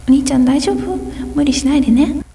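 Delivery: high, sweet little sister voice; caring little sister. Acted. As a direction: worry sweetly about big brother. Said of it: "女性だけで".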